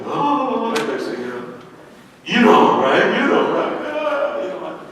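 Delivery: bawling. Retold or imitated. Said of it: "They're like bawling".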